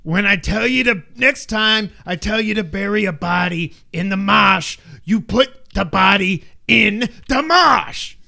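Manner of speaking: loud, high mock Boston accent; Jack Nicholson. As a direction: bellow it in a hammed-up Boston accent, slowing at the end. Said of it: "I'm doing the bad accent. Jack Nicholson".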